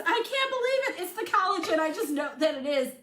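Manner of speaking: imitating mom's delighted text; excited mom. Mom texts daughter about healing, thrilled high voice. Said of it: "she says".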